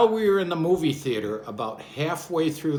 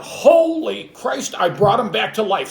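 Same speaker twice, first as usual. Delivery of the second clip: astonished thought; 6 semitones higher, 6 dB louder than usual. Retold or imitated. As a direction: gasp it out like a miracle happened. Thinks he revived the man.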